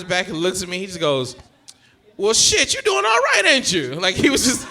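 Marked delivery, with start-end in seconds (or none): 2.2-3.9 high, loud, approving; hammed-up granddad congratulating, voice leaping up; impressed granddad. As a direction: imitate delighted amazement. Granddad's impressed verdict, acted.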